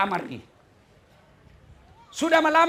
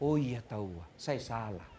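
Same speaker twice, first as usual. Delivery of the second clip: wife admitting fault; 13 semitones lower, 10 dB quieter than usual. Pretend. Wife realizes she was wrong.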